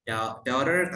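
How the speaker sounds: American "daughter", r sounded at the end; American pronunciation. Shown as a demonstration. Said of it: "saya lebih ke American ya". ("daughter" gaya Amerika)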